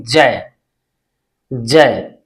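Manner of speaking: जय said as one syllable, jai; जय with the ai glide, not ja-ee; pronounce जय. Shown as a demonstration. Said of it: "क्या पढ़ते हैं?".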